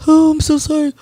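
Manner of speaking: high mock apology, pitched well up; fake remorse. Mocking the killer's fake apology, high pretend remorse. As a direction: sneer through a phony sorry. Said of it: "trying to so fake me remorse".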